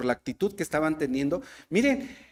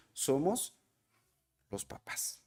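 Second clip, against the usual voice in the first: whisper; 4 semitones lower, 12 dB quieter, slower than usual. Acted. Preacher's dramatic reveal to the church.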